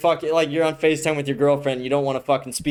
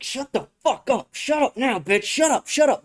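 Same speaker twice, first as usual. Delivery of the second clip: much higher, strained put-on voice ordering him to shut up; mock camera handler; 8 semitones higher than usual. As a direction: squeak out the orders like a panicking handler behind the camera. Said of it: "was like".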